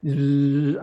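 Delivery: slow low buzzing bzzz; imitated bee buzz. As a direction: drag out a bee's buzz. Bee buzzing round the car.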